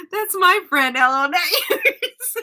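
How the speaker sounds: high, loud possessive teasing; mock jealousy. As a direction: protest it playfully, breaking into laughter. Playfully acts out friend's jealousy.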